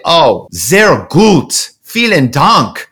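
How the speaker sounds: slow, drawn-out German; German accent. Acted. Posing as the Frankfurt office guy thanking the Chicago executive, German accent. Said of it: "I'm the guy in Frankfurt".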